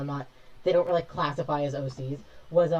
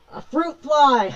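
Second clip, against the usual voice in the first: loud, much higher, drawn-out cry; much louder, higher startled exclamation; startled outcry; 11 semitones higher, 13 dB louder, much slower than usual. Real, not acted. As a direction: yell it slowly at the fly, alarmed. Fruit fly appears, startled.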